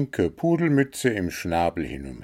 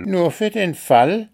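high, loud, mildly huffy; sulky grumble; 11 semitones higher, 6 dB louder than usual. A narrator's reading. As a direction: whine it high with a touch of sulk. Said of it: "maulte Spooky". (maulend, hoch und etwas beleidigt)

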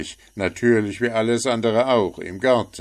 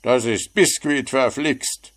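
louder, higher angry gnome; scolding; 6 semitones higher, 6 dB louder than usual. A narrator's reading. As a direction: bark the correction in fury. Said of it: "schimpfte Puh".

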